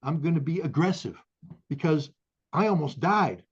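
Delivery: rising to a furious battle cry; pissed off. Real, not acted. To the host, a Marine vowing to fight: real fury. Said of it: "And I am pissed off".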